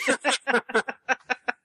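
bright high burst of laughter; real laughter. Real, not acted. Laughing at be British.

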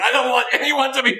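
high put-on voice, mocking; mock other voice. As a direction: voice someone else's opinion, high. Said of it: "Scott has weighed in".

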